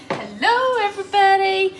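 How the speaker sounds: high, loud cheery hello; excited hello. Real, not acted. Greeting live viewers, high and loud. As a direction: sing out a greeting.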